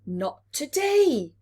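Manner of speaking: firm parent. Acted. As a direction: shut it down kindly but firmly. Parent refuses again.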